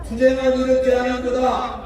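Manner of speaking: ordinary talk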